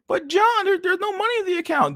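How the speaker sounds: falsetto wife voice, fretting; worried wife. Pretend. Streamer voicing the wife telling John there's no money, in high falsetto. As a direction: squeak out the money worry as the wife.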